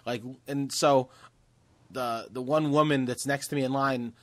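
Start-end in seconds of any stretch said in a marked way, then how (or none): none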